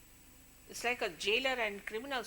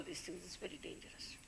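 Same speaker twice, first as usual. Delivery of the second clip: hushed warning; 3 semitones lower, 10 dB quieter than usual. Real, not acted. Guru warning her disciples, whispered ominous warning.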